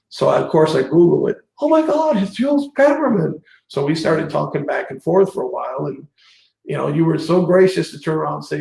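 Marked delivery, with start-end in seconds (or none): 1.6-3.4 recreate the jolt of knowing the name; retold amazed realisation. Realising who messaged him.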